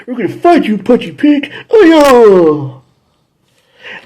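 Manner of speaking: high, loud cartoon hero battle cry. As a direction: cry out like a cheerful kid hero. Rangers voiced in retelling.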